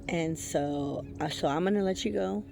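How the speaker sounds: normal talking voice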